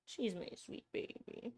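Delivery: whispered pet talk. Real, not acted. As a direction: breathe it softly to the pet. Whispering over her pet.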